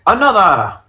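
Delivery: high loud call for another round; bar order shout. Retold or imitated. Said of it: "the performative element of being like".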